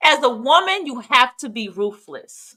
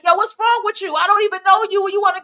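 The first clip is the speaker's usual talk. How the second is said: mock shout; 8 semitones higher, faster than usual. Pushing away title-giving strangers.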